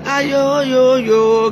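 loud, high sing-song "aïe yo yo"; wailing exclamation. Real, not acted. Streamer bemoaning her critics, loud sing-song wail. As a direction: wail it out on long vowels.

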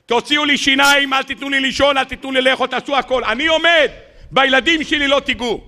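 high, urgent plea; desperate father pleading. Acted. Spy father begging interrogators to leave his kids alone, desperate. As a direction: beg them to spare your children. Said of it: "אומר".

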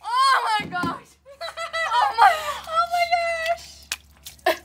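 high laughing scream; shocked shriek. Real, not acted. Freezing bucket just dumped.

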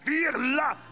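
loud shouting sermon, vire-le drawn out; loud pulpit command; fiery preaching. Acted. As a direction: hammer it home. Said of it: "preaching at full force".

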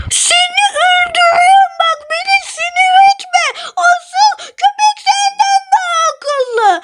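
fake-angry buddy snapping back; high friend voice shouting in jest; friend's mock threat.

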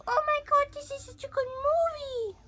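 high, loud gushing fan voice; imitating an overexcited viewer; mock excited fan. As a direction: gush like a thrilled moviegoer. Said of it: "You'll be like".

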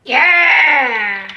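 loud excited yell; shout. Real, not acted. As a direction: cheer it out loud. Celebrating in game.

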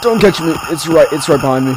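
high, loud, fast frightened cries; real terror, hurried; panicked pleading. Real, not acted. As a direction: beg as it closes in. Fleeing a monster.

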